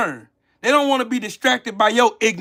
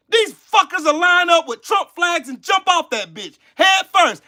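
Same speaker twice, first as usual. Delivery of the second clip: ordinary talk, nothing marked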